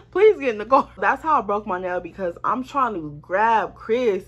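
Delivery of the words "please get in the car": louder plea with a laugh in it. Retold or imitated. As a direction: beg him while laughing. Laughing while quoting herself.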